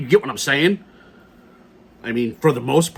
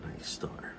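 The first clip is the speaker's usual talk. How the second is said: breathy whisper of the name "Night Star"; 16 dB quieter than usual. Real, not acted. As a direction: mouth "Night Star" under the breath, unvoiced. Mulling the gun's model name.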